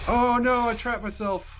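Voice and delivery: high, loud cry of dismay; real fright, cornered; panicked cry. Real, not acted. Trapped by monster.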